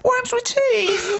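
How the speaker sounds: very high, very slow, squeaking with laughter; mock amazed reveal. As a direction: draw out the big reveal in a high, wobbling voice. Mocking the friend's news.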